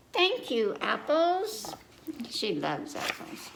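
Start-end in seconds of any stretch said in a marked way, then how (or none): 0.1-1.7 lightly higher little pig voice; delighted child thanks; Peppa voice. Acted. Peppa thanking apples, light childlike voice.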